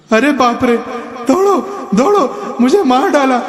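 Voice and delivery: high panicked cries for help; high-pitched terrified yelling; frightened shrieking. A narrator's reading. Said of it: "उसने डर के मारे चीखना शुरू किया".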